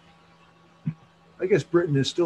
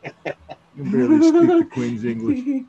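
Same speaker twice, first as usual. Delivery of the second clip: high, louder put-on British accent; mock posh British; 14 semitones higher, 7 dB louder than usual. Mocking a friend in a put-on British accent. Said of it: "you're not a very proper Brit".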